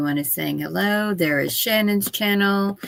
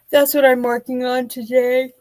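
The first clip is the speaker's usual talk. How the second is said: higher, louder words stretched by a real yawn; talking through a yawn; 5 semitones higher, 5 dB louder than usual. Real, not acted. Tired writer wraps up her update to chat, yawning. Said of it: "I'm sorry I keep yawning".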